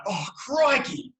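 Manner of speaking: high, drawn-out mock shock; a bit shocked. Shown as a demonstration. Author demonstrating Aussie exclamation to host, mock shocked. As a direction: exclaim it slowly in startled amazement. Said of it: "like you're a bit shocked".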